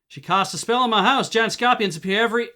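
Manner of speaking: put-on loud, high alarm in a Hadati accent. Trapper warning visitors of giant scorpions, alarmed Hadati accent.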